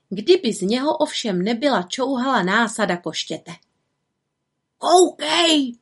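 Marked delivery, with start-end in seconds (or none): 4.8-5.7 high call of alarm; exclaiming raven. A narrator's reading. Havran points the little witch to a cloud, exclaiming. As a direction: draw attention with a raised cry. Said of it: "zvolal Havran".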